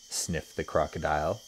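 usual speaking voice

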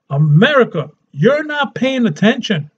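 ordinary talk

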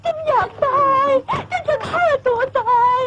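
high, sobbing wail; despairing cry. Acted. Pregnant girl sobbing to her friend, despairing.

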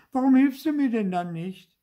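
high, loud complaint; plaintive protest. A narrator's reading. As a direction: protest like a wronged little brother. Puppet protests to fairy sister. (hoch und vorwurfsvoll)

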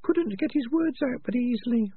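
high, sharp little rat voice; Peaches voice. Acted. Peaches tells Morris, in a high rat voice.

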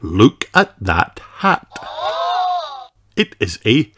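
plain reading voice

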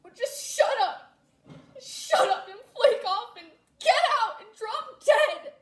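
high, loud screaming outburst; furious shout. Acted. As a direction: scream it at them with a cracking laugh. Furious outburst at snobby friends.

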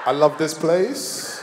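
very slow, low stoned drawl; mock blissed-out stoner; stoned worker. Acting himself stoned at work.

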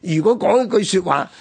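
normal talking voice

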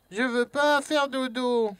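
put-on high, drawn-out child refusing bed; playful whiny child. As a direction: whine it slowly, like a kid at bedtime. Joue l'enfant au lit. (voix d'enfant qui refuse de dormir)

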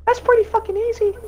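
put-on very high kid squeak; squeaky little kid voice; squeaker voice. Shown as a demonstration. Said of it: "wait, I can do it better".

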